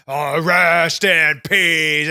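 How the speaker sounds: low, loud, very slow intoning; ominous, drawn-out catchphrase; Undertaker impression. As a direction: drag out each word like a wrestling undertaker.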